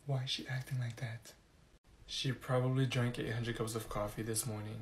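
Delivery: soft, low muttering aside; whispering. Acted. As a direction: mutter about the teacher. Students whispering.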